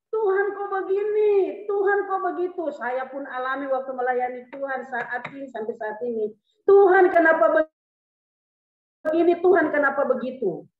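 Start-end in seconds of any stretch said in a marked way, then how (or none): 0.1-2.6 high, complaining challenge to God; protesting prayer, pitched high; mock protest. Acted angry protest to God in prayer. 6.7-10.7 loud, high complaint to God; acted-out protesting prayer, raised voice; mock angry protest.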